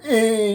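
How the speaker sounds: high drawn-out "eh" moan; imitated grunt.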